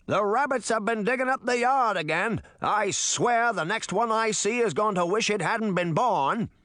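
put-on high-pitched cranky old man's holler; grumpy old man shouting. A narrator's reading. Senior citizen griping about rabbits, shouting crankily. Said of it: "they heard the man shout".

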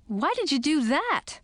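high-pitched, indignant question; indignant protest. Acted. Girl dumped on the ground by prince, indignant. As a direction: protest at being dropped, voice up high.

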